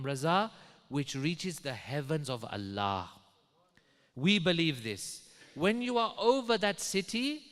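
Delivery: fervent preaching. Retold or imitated. Friend tells the pilot to pray over the city, fervent.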